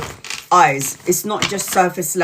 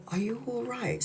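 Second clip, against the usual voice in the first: much softer, a caring "Are you all right?"; gentle concern; 4 semitones higher, 14 dB quieter than usual. Shown as a demonstration. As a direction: ask it softly, to disarm the anger. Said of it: "you go".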